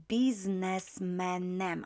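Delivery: biznesmenem drawn out slowly, every syllable spelt out; slow clear demo. Shown as a demonstration. Teacher spelling out the instrumental ending slowly. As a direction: stretch biznesmenem so the ending is heard. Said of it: "I'm using instrumental".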